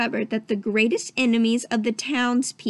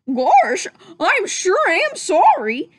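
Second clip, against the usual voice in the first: loud, high, slow goofy drawl; 8 semitones higher, 6 dB louder, slower than usual. Acted. Goofy apologizes to the prisoners, goofy and loud. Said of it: "cried Goofy".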